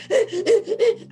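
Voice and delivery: high burst of laughter; a big loud laugh. Real, not acted. Laughing while retelling breakthrough.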